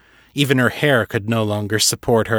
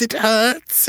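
sing-song "hurts" swooping much higher on a rise; caroling vampire; 12 semitones higher than usual. Acted. Fisher mocking Seffi's pain, high sing-song caroling. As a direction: lift "hurts" high and gleeful. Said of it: "he caroled".

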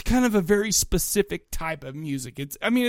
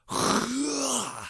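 long exasperated sigh; heavy sigh; 4 semitones higher, 6 dB quieter than usual. Retold or imitated. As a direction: let out a weary sigh. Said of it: "And I was like".

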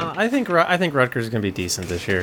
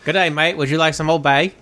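hammed-up Aussie greeting, higher and louder; jokey fake Australian accent, pitched up; mock Australian accent; 7 semitones higher, 4 dB louder than usual. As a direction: play a cheesy Australian offering Old Bay. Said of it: "That's what they say".

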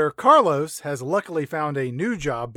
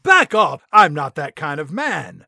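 loud mock-offended refusal; mock indignant shout; 6 dB louder than usual. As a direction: shove them away with a hammed-up cry. [name] pushes Alexa away.

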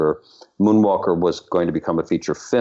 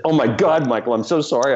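retold shocked apology; 7 semitones higher than usual. Retold apology after realising.

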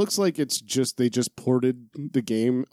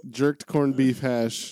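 slow mock Rasta accent, laughing; Irish Rastafarian accent; much slower than usual. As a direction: drag it out in a hammy Jamaican lilt. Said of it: "that was my Irish Rastafarian accent".

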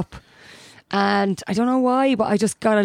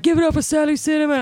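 higher, fast DJ hype; hyping MC shout-out; 7 semitones higher, faster than usual. Retold or imitated. Flash giving her a shout-out, hyped and quick. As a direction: hype the crowd like an MC. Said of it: "He's like".

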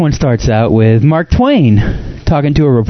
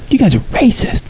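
mock accusation; 11 semitones higher than usual.

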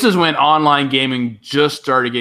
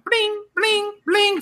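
high-pitched game sound effect; imitated game bling; much slower than usual. Gamer mimicking the endless jumping in Zelda two, high blings. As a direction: sing out high little blings.

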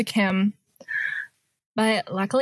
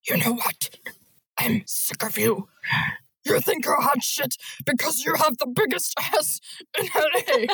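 deep, breathy gorilla voice, cracking into laughs; a lightly voiced gorilla that keeps breaking up; deeper gorilla voice; 3 semitones higher than usual. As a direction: go even deeper, ape-like, barely holding it together. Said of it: "I can go deeper".

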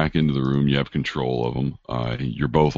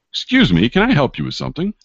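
raised, high-pitched call to a stranger; 11 semitones higher, 5 dB louder, faster than usual. Acted. Stranger challenging an intruder at the gate, calling out. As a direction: call out sharply in a higher voice. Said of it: "someone calls out".

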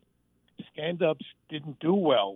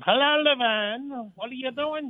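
Squiggy; 12 semitones higher than usual. Retold or imitated. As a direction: pitch it way up and stretch the greeting like a goofy sitcom neighbor. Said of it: "and then Squiggy goes".

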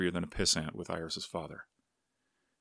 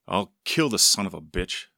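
loud, punched threat; furious father's threat, narrated with force; deep-voiced angry father; 8 dB louder than usual. A narrator's reading. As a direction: hit the threat hard and loud. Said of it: "the deep voice said".

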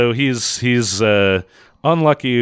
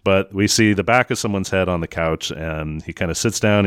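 normal talking voice

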